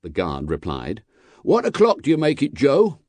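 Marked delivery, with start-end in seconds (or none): 1.4-3.0 high, brisk coachman's call. A narrator's reading. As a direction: call the question briskly to a mate. Coachman calls out to guard.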